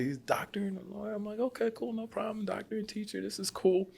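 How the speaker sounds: high, soft talking-himself-down; forced calm. Retold or imitated. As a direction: quietly insist it's all fine. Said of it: "I'm looking like".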